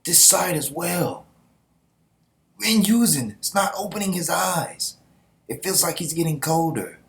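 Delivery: high, anxious report; lightly voiced panicking soldier; worried soldier. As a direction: sound alarmed as the body goes cold. Voicing soldier over fallen comrade.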